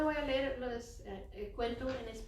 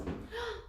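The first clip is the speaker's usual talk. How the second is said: mock gasp; 10 semitones higher, 4 dB quieter than usual. Delight at lamb.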